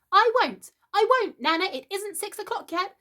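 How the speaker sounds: put-on high, loud boy shouting his refusal; child's tantrum. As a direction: stamp your foot like a small boy who won't be bathed. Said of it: "he had shouted".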